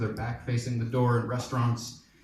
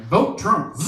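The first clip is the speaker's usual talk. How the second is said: loud, high mock war cry; hammed-up fanatical shout; suicide bomber battle cry; 8 semitones higher, 7 dB louder than usual. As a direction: yell it like a kamikaze slogan. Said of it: "suicide bomber style".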